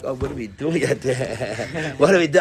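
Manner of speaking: mock-puzzled question dissolving into chuckles; laughing retelling. Teacher acting out his puzzled reply to the Rebbe, laughing. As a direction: break into laughter mid-quote.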